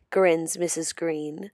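ordinary delivery

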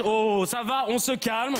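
normal talking voice

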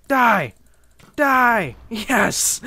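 high, very loud yells; battle yell. Real, not acted. Player attacking an opponent in the game, triumphant battle yell. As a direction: shout it in triumph mid-kill.

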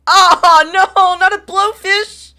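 high loud wail of dismay; dismayed cry. Real, not acted. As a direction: cry out in horror. Dismay at blowfish.

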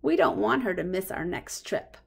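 usual speaking voice

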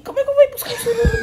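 high laughing shout. Real, not acted. Laughing protest about the sururu.

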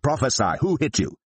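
high, jeering challenge to the blindfolded man; mocking taunt. A narrator's reading. Guards taunting blindfolded Jesus, jeering high mock. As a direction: sneer it up at him like a cruel game. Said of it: "began mocking and beating him".

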